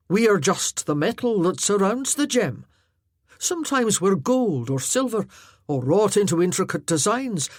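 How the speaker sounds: lightly voiced Highland warrior, heartfelt; earnest Highland warrior. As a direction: speak plainly and earnestly to the lass. Arter, big Highland warrior speaking.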